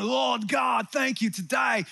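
put-on botched British accent sliding into Australian; Australian-sounding British accent. Worship leader unconsciously mimicking his British heroes, accent sounds Australian. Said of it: "my British accent sounds Australian".

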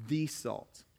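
single out "the" in "the salt"; pointing out the definite article in the Greek; stress on the. Shown as a demonstration. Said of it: "notice the definite article".

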